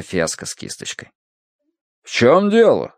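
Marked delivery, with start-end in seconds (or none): 2.0-2.9 higher, mildly menacing question; stern demand. A narrator's reading. Irate father walks in on the room, stern. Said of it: "спросил он грозно".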